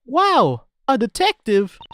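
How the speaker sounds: put-on boy voice pitched high and loud, first line slowed; Luke voice. Boy assistant boasting to the Professor, high and eager.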